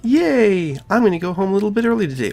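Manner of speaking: a touch of high chirpy cheer; chirpy girl voice. Acted. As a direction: bounce through it like a forced-cheery girl. Voicing Sayori's cheerful exit.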